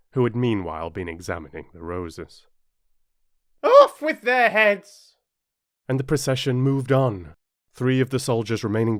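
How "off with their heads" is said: loud, high, drawn-out shout; Queen's shouted order. A narrator's reading. Queen condemning the gardeners, high loud shout. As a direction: shout the sentence out, high and slow.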